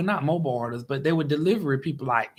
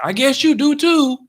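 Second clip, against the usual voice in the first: sarcastic retort; 9 semitones higher, 8 dB louder than usual. Retold or imitated. Mocking reply to cashier.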